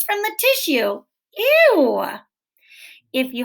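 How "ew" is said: high, loud grossed-out ew; a kid's yucky ew; disgusted ew. Acted. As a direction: squeal it like something gross touched you. Disgust at snot on hands.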